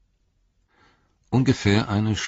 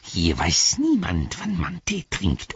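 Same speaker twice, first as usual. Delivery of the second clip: slow, rasping creature retort; Gollum hissing; 2 semitones higher, slower than usual. A narrator's reading. Gollum retorts to Sam about teatime, hissing. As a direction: throw it back with a hiss. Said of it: "fauchte Gollum".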